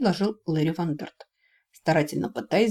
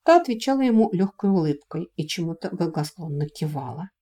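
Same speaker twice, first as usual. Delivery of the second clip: ordinary delivery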